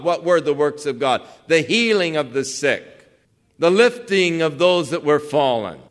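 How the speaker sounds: high, loud, ringing sermon climb; full-force preaching. Acted. Preacher driving home the works of God to the congregation, in full-force preaching. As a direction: build the sermon to a fervent peak.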